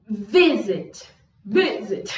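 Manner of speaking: Visit with a jolt on the first syllable; stress on vi. Shown as a demonstration. Teacher showing the hiccup at the start, stressed Vi in Visit. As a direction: punch Vi and let sit drop. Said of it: "dá um soluço no início".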